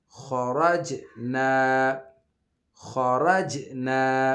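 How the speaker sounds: خرج نا slow, heavy ر, bounced ج; heavy kha, qalqalah. Shown as a demonstration. Teacher drilling the word for pupils, slow and bounced. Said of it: "اور جیم پہ پھر ہم قلقلہ کریں گے".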